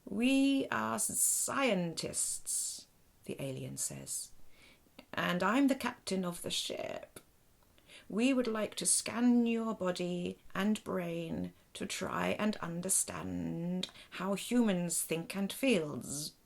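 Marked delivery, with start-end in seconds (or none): 0.0-2.9 very slow, higher buzzing alien voice; creaky robot alien. Acted. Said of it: "her voice is rather creaky and awkward, with a buzz to it". 5.1-16.3 louder, buzzing alien captain voice; awkward machine-translated speech; creaky robot alien. Acted. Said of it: "her voice is rather creaky and awkward, with a buzz to it".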